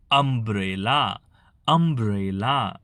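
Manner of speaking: slow, drawn-out અમ્બ્રેલા starting 'um'; correct umbrella. Shown as a demonstration. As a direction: stretch અમ્બ્રેલા into 'um-brey-laa' for learners. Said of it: "આ રીતે ઉચ્ચાર થશે ખાસ". (અમ્બ્રેલા ધીમેથી ખેંચીને, 'અ'થી શરૂ)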